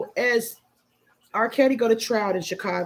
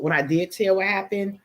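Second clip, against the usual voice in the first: ordinary delivery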